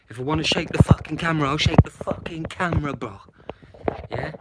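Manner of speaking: defiant outburst. Real, not acted. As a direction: snap back at the chat, fast and loud. Defying a viewer's comment.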